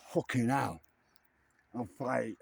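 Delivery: ordinary talk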